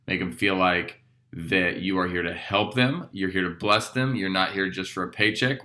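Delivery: ordinary talk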